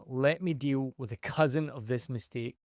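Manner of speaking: normal talking voice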